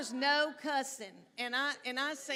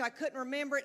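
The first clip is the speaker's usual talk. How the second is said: usual speaking voice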